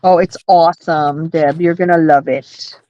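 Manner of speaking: ordinary talk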